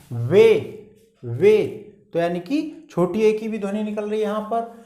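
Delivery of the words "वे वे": वे said loud, repeated for the class; emphatic demo word. Shown as a demonstration. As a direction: hit वे firmly twice. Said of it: "जैसे W ए Y".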